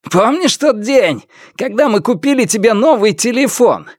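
high-pitched gloating villain; openly proud of himself. A narrator's reading. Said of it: "Он откровенно гордился собой". (самодовольно, высоким злорадным голосом)

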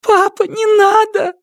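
very high sobbing scream; crying shout. Acted. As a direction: beg him to stop through tears. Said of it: "всхлипнула девочка".

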